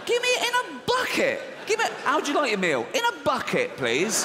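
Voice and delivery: high, pushy squeaky demand; mock greedy fat customer. Comedian mimicking a fat person ordering food, high and greedy. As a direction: squeak it like a spoilt glutton. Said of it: "fat people just go and go".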